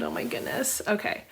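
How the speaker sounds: normal talking voice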